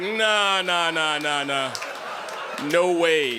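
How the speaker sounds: mock producers waving it off; drawn-out descending refusal; dismissive refusal. Producers rejecting the audition.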